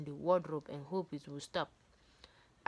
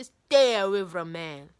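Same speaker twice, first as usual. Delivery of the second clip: much louder, slow, stern command; stern warning; 12 dB louder, slower than usual. Retold or imitated. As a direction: lay down the rule slowly and loudly like a strict mother. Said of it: "They just say".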